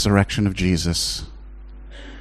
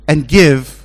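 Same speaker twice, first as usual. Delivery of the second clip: grudging giver; 7 semitones higher, 3 dB louder, much slower than usual. Retold or imitated. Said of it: "you're gonna ask yourself".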